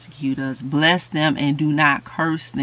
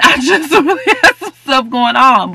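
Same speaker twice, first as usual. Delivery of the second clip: laughing; 10 semitones higher, 8 dB louder than usual. Real, not acted. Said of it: "laughing at herself".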